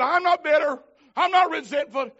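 loud, high, insistent shout; heated denial. Retold or imitated. Wife denying bitterness during their argument, shouting. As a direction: snap back defensively.

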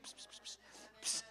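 soft unvoiced psst calls; whispered psst. God nudging a distracted believer, whispered psst. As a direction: hiss psst to get attention.

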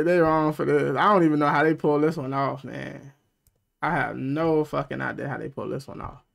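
ordinary talk, nothing marked

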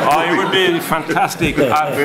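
chuckling through the words, slowing on silverback; laughing. Real, not acted. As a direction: joke it out while laughing. Joking about naked monkey actors.

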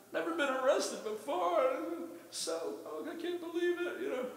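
put-on slow, high, whimpering girl voice; crying young girl. Arrested girl on the bench wailing, high and slow. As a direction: wail it like a scared young lady. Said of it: "like a young girl".

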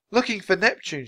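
restrained, high-pitched shout; lightly acted calling voice. A narrator's reading. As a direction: call back an answer over the wind. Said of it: "shouted Annie".

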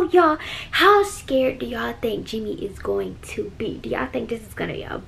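hushed whisper. Real, not acted. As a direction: keep it to a whisper. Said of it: "why I'm whispering".

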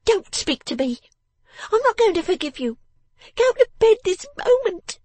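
very high, loud, cross command; snapping. A narrator's reading. Miss Wren interrupts her father's excuse, snapping. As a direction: cut him off in a high scolding voice. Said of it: "snapping him up".

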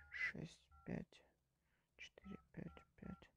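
whispered countdown; counting under the breath. Real, not acted. Шёпотом считает, прячась. (шёпотом)

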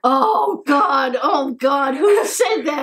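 can't believe anyone would say that; shocked outrage. Real, not acted. Reacting to a cruel comment about them, shocked and loud.

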